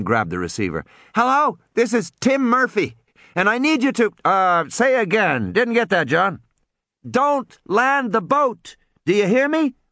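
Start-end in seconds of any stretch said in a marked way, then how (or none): 1.1-6.4 put-on much higher boy's voice, eager; a kid grabbing the receiver in a rush; young boy talking into a radio; boy on the phone. Boy Tim answers the radio. 7.1-9.8 put-on high, slow, pleading boy's warning; boy urgently warning the ship captain, high and slow. Tim urgently warns the captain.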